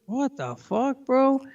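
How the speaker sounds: slow, high, drawn-out disbelief; incredulous reaction. Retold or imitated. Retelling his own reaction to the short song, slow incredulous disbelief. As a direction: stretch it out in mock shock. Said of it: "Like, I was like".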